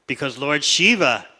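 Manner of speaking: normal talking voice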